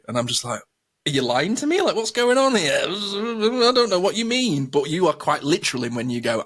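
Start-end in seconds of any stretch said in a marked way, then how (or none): 1.1-4.7 rising higher and higher in bafflement; hammed-up disbelief, pitched up; mock confused. Retold confused reaction to partner.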